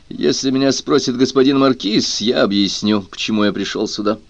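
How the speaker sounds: ordinary delivery, nothing marked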